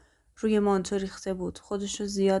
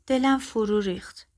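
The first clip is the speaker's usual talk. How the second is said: usual speaking voice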